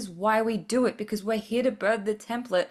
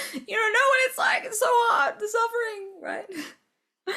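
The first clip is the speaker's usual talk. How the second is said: shrill, much higher, loud mocking whine; mock whiny sufferer; 14 semitones higher, 4 dB louder, slower than usual. Mocking a self-pitying listener, loud high whiny wail. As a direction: parody a self-pitying listener crying about how hard it is.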